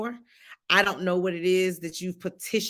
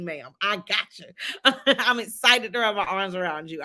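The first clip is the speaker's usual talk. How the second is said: ordinary talk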